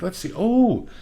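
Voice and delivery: high, drawn-out fake admiration; mocking gawking couple. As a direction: coo over the gym bench like a clueless tourist.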